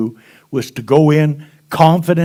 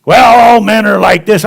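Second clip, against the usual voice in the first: mock complaint; 5 semitones higher, 9 dB louder than usual. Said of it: "both of 'em all said".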